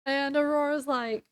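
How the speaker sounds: ordinary talk, nothing marked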